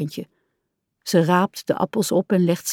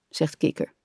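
plain reading voice

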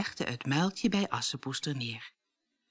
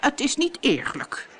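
higher sulky pout on "niet eerlijk"; a higher, whiny pout, "niet" leaned on; 8 semitones higher than usual. A narrator's reading. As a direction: sulk like a spoiled stepsister. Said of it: "pruilde Bertha".

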